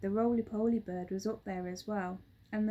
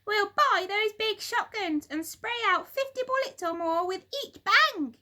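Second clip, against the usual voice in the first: much higher, loud shrill nag; shrill old wife; 12 semitones higher, 7 dB louder than usual. A narrator's reading. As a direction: screech it like a cranky old wife. Missus Twit voiced.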